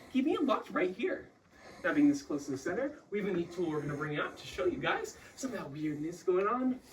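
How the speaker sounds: normal talking voice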